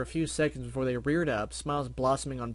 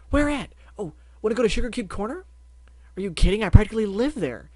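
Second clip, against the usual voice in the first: higher, loud and fast; excited pony chatter; 9 semitones higher, 9 dB louder than usual. Acted. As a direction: rush ahead with glee. Pinkie and Barry excited.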